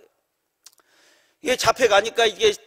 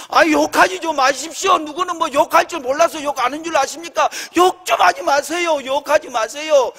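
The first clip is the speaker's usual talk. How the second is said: raised, higher-pitched remonstrating; retold heated protest; 4 semitones higher than usual. Pastor to the cursing boss, losing patience, heated protest. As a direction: re-enact telling someone off for cursing, insistently. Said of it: "내가 얼마나 열받은지".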